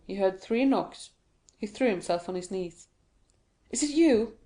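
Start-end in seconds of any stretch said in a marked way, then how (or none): 3.7-4.4 eager. Acted. Dantès hears the knocks.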